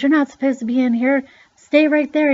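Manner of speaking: high, loud, urgent retold order; alarmed scolding. Repairman tells trespassing child off, alarmed scolding. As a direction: scold and command, imitating the startled man.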